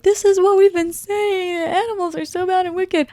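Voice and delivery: shrill mock outrage over the animals; Madame Morrible impression. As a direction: ham up her fake outrage. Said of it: "she's trying to be like".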